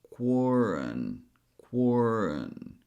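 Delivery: QUARREN drawn out slowly, its second vowel a light schwa; weak second syllable. Shown as a demonstration. Teacher showing learners the syllables so far, "quarren" with a weak schwa. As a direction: say "quarren" slowly, with an unstressed "uh" before the N. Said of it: "when a vowel is not emphasized".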